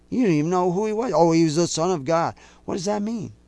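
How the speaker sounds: ordinary talk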